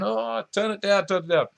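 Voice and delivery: high, mock-pleading viewer begging him to turn it down; mock viewer complaint. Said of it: "you'll be going".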